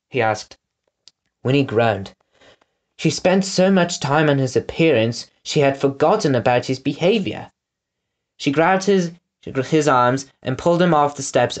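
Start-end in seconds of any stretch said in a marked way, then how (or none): none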